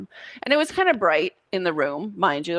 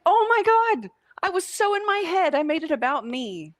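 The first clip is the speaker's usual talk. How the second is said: pitched way up, aghast at herself; oh my God; 11 semitones higher than usual. Retold or imitated. Coach retells realizing she was in her head, high pitched.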